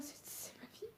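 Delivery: high, broken, whispered sob; crying almost soundless; snotty crying. Real, not acted. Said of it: "Je suis désolée si je pleure".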